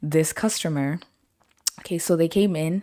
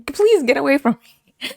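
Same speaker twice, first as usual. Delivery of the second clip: mock plea; 8 semitones higher, 5 dB louder than usual. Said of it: "'Cause I was like".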